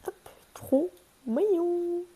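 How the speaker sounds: high, slow cutesy coo. Real, not acted. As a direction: gush over how cute it is. Cooing over a pyjama top.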